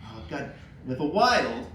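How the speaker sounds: ordinary talk, nothing marked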